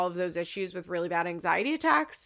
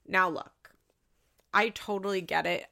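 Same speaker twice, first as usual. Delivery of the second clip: ordinary delivery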